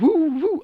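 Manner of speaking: high, loud whooping cries; high mock whooping. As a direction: whoop like she's twirling something. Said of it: "she's standing there going".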